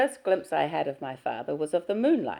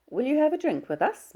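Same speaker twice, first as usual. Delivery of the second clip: put-on kindly older employer, higher and louder; Mrs Muir's voice; 11 semitones higher, 5 dB louder than usual. Employer reassures Diana about the visit, kindly and raised. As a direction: reassure the girl, brisk and kind.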